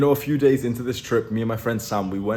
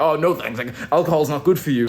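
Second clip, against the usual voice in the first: mildly mocking, preachy refusal, pitched up; higher-pitched, self-righteous brush-off; 4 semitones higher than usual. Shown as a demonstration. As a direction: imitate a judgmental refusal of a drink. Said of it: "like, a dickhead, offensive way".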